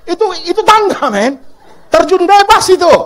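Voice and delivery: loud, high fervent preaching; full-force preaching. Acted. Preacher rouses the congregation, loud and fervent. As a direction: belt it out to the whole church.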